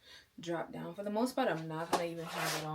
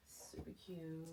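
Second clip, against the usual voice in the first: whispering; 4 semitones lower, 15 dB quieter, slower than usual. Real, not acted. Murmured to herself.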